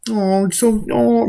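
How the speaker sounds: high, mocking coo as if soothing a baby. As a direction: coo at them like a pampered infant, sarcastic. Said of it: "no one's gonna sit there and be like".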